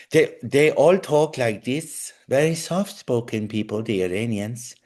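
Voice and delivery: slowed, put-on Iranian accent. Shown as a demonstration. Host showing how Iranian friends talk, Iranian accent. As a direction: imitate an Iranian accent, deliberately. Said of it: "they all have the same sort of accent".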